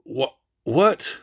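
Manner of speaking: normal talking voice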